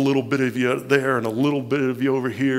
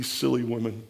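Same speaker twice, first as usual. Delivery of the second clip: ordinary delivery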